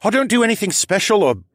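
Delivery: loud, high-pitched dragon son; flustered protest. Acted. Callan protesting to his mother, loud and flustered.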